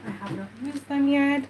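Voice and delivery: usual speaking voice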